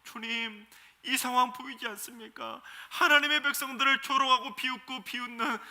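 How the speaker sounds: 높고 큰 소리로 간절히 부르짖듯; 백성의 마음으로 하나님께 크게 애원하며; 눈물로 호소하는 기도. Retold or imitated. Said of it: "눈물로 호소하며 애타게 기도를". (하나님께 울부짖는 백성처럼, 높고 크게 애원하는 기도)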